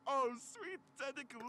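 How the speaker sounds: high, slow mock-aroused exclamation; aroused outburst. Nurse hoisted by tentacles, aroused outburst. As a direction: burst out in hammed-up delight. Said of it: "I burst out, getting aroused again".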